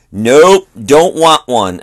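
loud, curt mock refusal; sour refusal. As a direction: imitate a sour man turning down an offer. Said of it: "he was giving sour snare looks".

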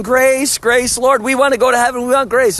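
high mocking whine of the hypocrites. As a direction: parody hypocrites begging for grace. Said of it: "These are all saying".

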